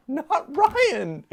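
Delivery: high, whining plea of disbelief. Real, not acted. Begging the show to spare Ryan, high whining. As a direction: wail it up high like a protest. Repeats in higher whine.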